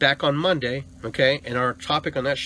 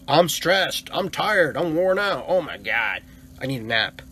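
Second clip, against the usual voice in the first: louder, higher mock complaint, hammed up; mock whining complaint; 3 semitones higher, 4 dB louder, slower than usual. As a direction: parody someone moaning they're worn out. Mocking people complaining about stress.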